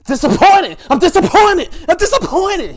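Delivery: high, loud repeated yelling; going crazy. Retold or imitated. Imitating Chris losing it over disappointment, crazed shouting. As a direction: shout it in a mounting frenzy. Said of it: "he just started going crazy".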